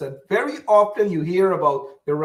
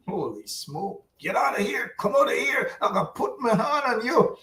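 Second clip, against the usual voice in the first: put-on old-time elder scolding; 5 semitones higher than usual. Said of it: "I remember long ago".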